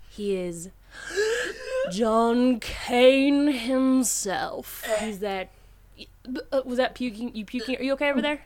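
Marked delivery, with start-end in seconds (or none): 0.9-1.9 a sharp high gasp of surprise; mock shocked gasp. Retelling the villain reveal, a mock shocked gasp. 1.9-4.8 very slow, weighty announcement; dramatic slow reveal. Retold or imitated. Naming the hidden villain, a slow dramatic reveal.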